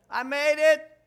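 loud, high victory cry; triumphant shout. Real, not acted. Preacher declaring he beat the devil's lie, loud and high.

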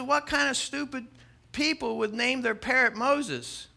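high-pitched incredulous ridicule; amused scorn. Acted. Burglar ridiculing the parrot's owners, high and scornful. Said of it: "The guy starts laughing".

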